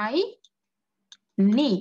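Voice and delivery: আইনি stretched very long with the ai held, a rising end; drawn-out drill. Shown as a demonstration. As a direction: hold আইনি out slowly, ending up. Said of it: "উচ্চারণটা কি পাবো শেষে".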